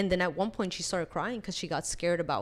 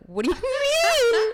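high, drawn-out mock shriek; shocked outcry; 6 dB louder, slower than usual. Stunned sibling answering sister's revelation, high exaggerated shriek. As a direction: stretch it into a loud, incredulous squeal.

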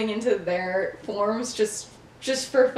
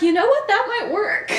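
high, giggling through the line; laughing as Sirius agrees; laughing quote; 11 semitones higher, 5 dB louder than usual. Retold or imitated. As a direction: quote him while cracking up.

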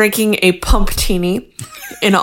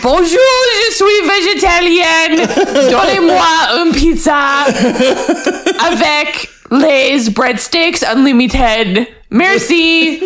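fake French accent; 10 semitones higher than usual. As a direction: play a clueless tourist faking French. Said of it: "sounds like some American going into, like, the Olive Garden Paris".

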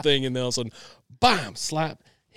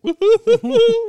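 high, loud gleeful hooting; hammed-up cackling whoop; 12 dB louder than usual. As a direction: whoop like a kid watching a brother get caught. Kid cheering on dad.

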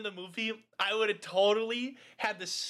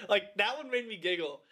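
ordinary talk

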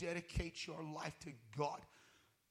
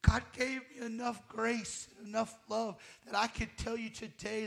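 loud, raised preacher's cry; fiery preaching; 5 semitones higher, 7 dB louder than usual. Real, not acted. Preacher confessing to the church, loud and raised. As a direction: drive it home to the congregation.

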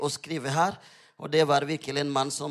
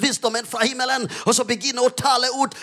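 urgent sermon swelling to loud, higher declarations; fervent preaching, building; 7 semitones higher, 9 dB louder than usual. Real, not acted. Preacher urging believers to speak out, fervent preaching building in force. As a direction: build relentlessly into bold proclamation.

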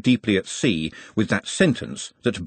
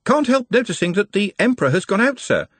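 cheerful; 10 semitones higher than usual. A narrator's reading. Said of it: "said the voice from below cheerfully".

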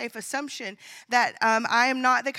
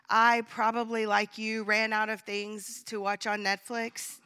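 normal talking voice

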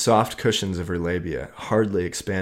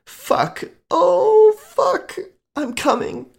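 very high, loud cries breaking; climax cry; 8 dB louder, much slower than usual. A narrator's reading. Lover crying out at her climax, very high and loud. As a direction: cry out over the top.

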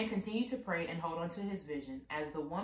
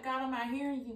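mock frustrated plea; 7 semitones higher than usual. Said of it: "You're like".

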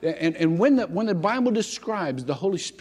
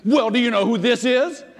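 higher, louder sly counter-question; cheeky young voice; 6 semitones higher, 6 dB louder than usual. Retold or imitated. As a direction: turn the question back on the boss. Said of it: "this young voice comes over".

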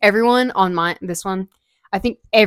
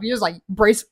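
normal talking voice

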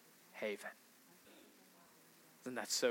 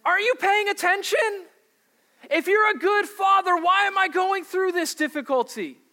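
shouted accusing prayer; 14 semitones higher, 8 dB louder than usual. Acted. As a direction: hurl the complaint up at heaven.

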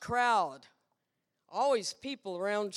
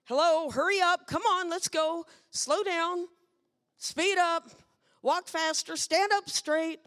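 higher, louder mock parent orders; nagging parent; 6 semitones higher, 4 dB louder than usual. Parent firing orders at a kid, mock nagging. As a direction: bark the chores like a fed-up parent. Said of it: "We repeat".

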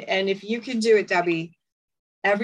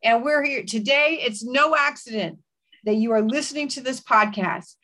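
fervent declaring prayer; 3 semitones higher than usual. Acted. Host praying over a suicidal listener, fervent declaration. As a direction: speak life over the listener.

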